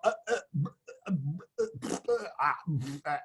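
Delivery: halting "uh" noises with long gaps; mock speechless stammer; tongue-tied stammering. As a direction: stall and sputter as if lost for words. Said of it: "Is that Martian?".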